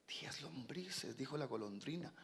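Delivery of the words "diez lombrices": slow, whispered amazement; awed hush. Acted. Young swallow hearing the fox's bigger offer, awed. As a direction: breathe it out very softly, stunned.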